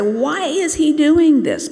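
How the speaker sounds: high, loud, drawn-out challenge; acting out an affronted Pharisee's question; indignant demand. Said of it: "pull, you know, the disciples to the door and say".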